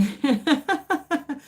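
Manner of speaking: high, rippling real laugh; giggling laugh. Real, not acted. Streamer tickled by the name confusion, rippling giggle. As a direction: let out a run of high giggles.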